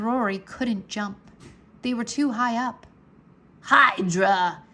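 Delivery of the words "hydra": loud commanding yell. A narrator's reading. Maldred ordering the Hydra to attack, a loud yell. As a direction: bark the attack order at the beast. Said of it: "yelled Maldred".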